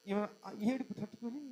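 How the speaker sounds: normal talking voice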